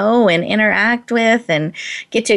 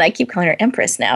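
normal talking voice

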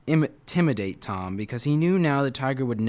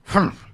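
tiger huff; 7 semitones higher, 5 dB louder than usual. A narrator's reading. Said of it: "the tiger huffed".

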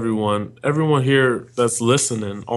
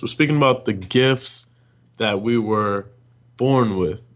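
ordinary talk, nothing marked

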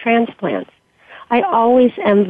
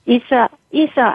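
calling her name, raised and higher; shouting over noise; 4 semitones higher than usual. Acted. Wife calling to her dying friend over commotion, raised shout. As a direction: call out over the noise of the room. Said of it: "I shouted over the commotion".

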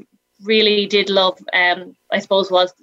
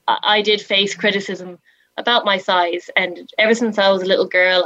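ordinary talk, nothing marked

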